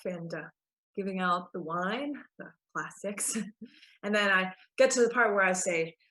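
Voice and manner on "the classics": ordinary talk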